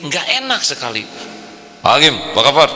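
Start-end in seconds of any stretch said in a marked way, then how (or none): 1.9-2.7 hearty loud greeting. Retold or imitated. Forceful handshake greeting.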